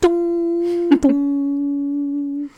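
high ding-dong cabin chime; chime imitation. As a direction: mimic the seatbelt chime.

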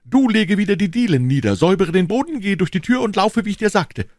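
high, loud commands; urgent shouted orders. A narrator's reading. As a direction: rattle off orders in great agitation. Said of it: "rief D'Artagnan".

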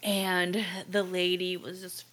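normal talking voice

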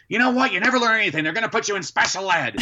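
high exasperated mock scold; exasperated mom. Mom losing patience with her son, high mock scolding. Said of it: "my mom would go crazy".

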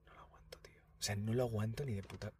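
whispered aside; whispering. Real, not acted. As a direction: whisper it so Marcos won't hear. Whispering about Marcos nearby.

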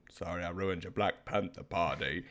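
soft, low mock Forrest Gump line; mom's Forrest Gump quote. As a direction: mom quietly quoting the movie after a pause. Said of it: "Then she'll be like".

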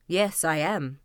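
plain-spoken Kuzma. A narrator's reading. Kuzma answering the fox, low and simple.

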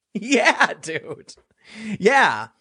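laughing sarcastically. Real, not acted. Mocking the tweeters, laughing.

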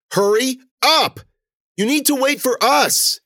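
high, loud shout with drawn-out words; calling out. A narrator's reading. Woman telling the runaway girl to wait, shouting. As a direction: shout slowly so it carries down the road.

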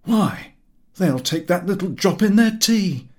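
a jolly skipper brushing off the worry; breezy skipper. A narrator's reading. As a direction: wave the worry away like a jolly seaman. Goyles answers the narrator.

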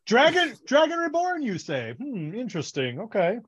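laughing mock interest in a high voice; mock clueless. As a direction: play dumb, pretending this is news. Mocking Rand's clueless reaction.

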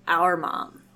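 usual speaking voice

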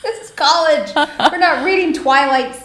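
high, loud mock disbelief; retold incredulous reply. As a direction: act out a stunned teacher's what. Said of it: "And I even said".

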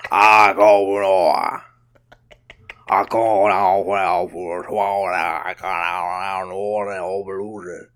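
low New Orleans drawl, loud start then fading; exaggerated New Orleans drawl. Podcaster hamming up his origins, garbled New Orleans accent. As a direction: slur it into near-gibberish New Orleans talk. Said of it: "That is not the same voice you were doing".

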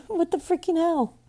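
high, exasperated outburst after a laugh; incredulous laugh. Real, not acted. Survivor looking back at her own denial, incredulous laughing.

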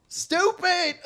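high, loud mock country shout of stupid; country accent. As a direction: yell stupid in a country twang. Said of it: "He said it in the country accent".

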